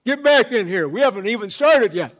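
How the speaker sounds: loud, high call to come back in; loud church elders calling back. Retold or imitated. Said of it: "they say".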